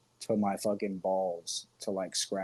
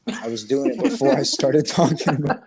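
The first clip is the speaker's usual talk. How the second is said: laughing while talking, louder and higher; 5 semitones higher, 8 dB louder than usual. Real, not acted. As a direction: laugh through the words. Laughing at own confession.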